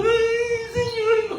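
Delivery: imagined Paul facing execution, mock wail; mock trembling whimper. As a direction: whimper like a coward. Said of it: "no me imagino a Pablo temblando".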